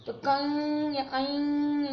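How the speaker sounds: कंग and ऐंग drawn out slowly, nasal ng held; teacher demonstrating the ng sound slowly; nasal sound demo. Shown as a demonstration. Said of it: "यंग की जो आवाज".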